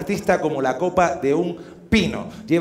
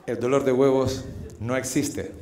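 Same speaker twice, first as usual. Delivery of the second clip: confidential reveal; 8 semitones lower, 9 dB quieter than usual. Acted. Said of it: "voy a romper un mito y un secreto".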